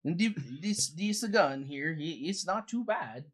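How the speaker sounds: sing-song Swedish-Canadian character voice; Credence. Acted. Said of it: "I'm good old Swedish Canadian".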